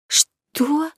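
sharp high cry of shock; shocked outburst. A narrator's reading. Countess shocked at the news, high cry. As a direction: blurt it out in disbelief. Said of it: "не совладав с эмоциями".

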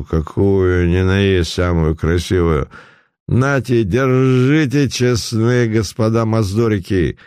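put-on slow drunken shouting. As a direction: holler like a drunk rich man. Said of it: "Путилин пьяным голосом закричал".

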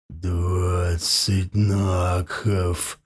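very low, slow, savouring each word; savouring werewolf voice. A narrator's reading. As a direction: drawl it out low like a beast. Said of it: "смакуя каждое слово".

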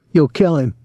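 lightly mocking, slightly high girl voice; imitating the girl. A narrator's reading. Tom chuckling after the fight, mild girl imitation. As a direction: mimic her cry, toned down. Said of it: "trying to imitate the girl's voice".

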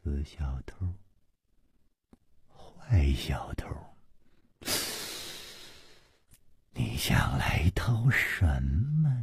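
low, slow murmured whisper to herself. Acted. Mad young master murmuring at the mirror, low whispered. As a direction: breathe the words almost soundlessly, slowly. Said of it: "喃喃地说".

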